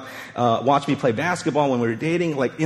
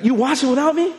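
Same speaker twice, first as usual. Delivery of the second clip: mock hurt; 8 semitones higher than usual. Indignant at wife.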